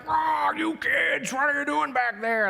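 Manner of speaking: high, drawn-out mock holler; yelling bus driver. Bus driver shouting back at the kids, exasperated.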